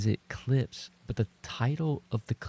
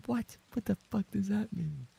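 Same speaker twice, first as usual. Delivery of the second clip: high, bewildered; high confusion; 11 semitones higher, 3 dB quieter than usual. Real, not acted. Streamer baffled by chat, high bewildered squeak. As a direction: squeak out the question.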